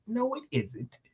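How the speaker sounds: high, soft mimicry of a woman contradicting; small high mock-female voice.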